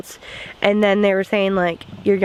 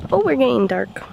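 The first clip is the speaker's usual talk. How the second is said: normal talking voice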